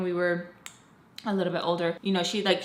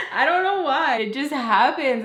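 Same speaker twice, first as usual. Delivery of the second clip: laughing; 6 semitones higher, 5 dB louder than usual. Real, not acted. Laughing at herself.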